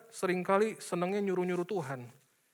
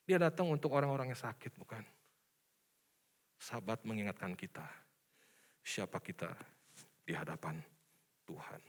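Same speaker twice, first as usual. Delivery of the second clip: hushed reflective drop; 11 semitones lower, 11 dB quieter than usual. Acted. Preacher drops to hush.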